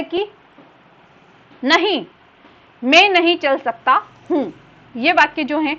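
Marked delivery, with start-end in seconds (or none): 1.6-4.5 "नहीं" alone, falling, then the sentence; pause after नहीं. Shown as a demonstration. Teacher reading an example, comma pause after "नहीं". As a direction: halt after the first "नहीं". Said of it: "उसके बाद में".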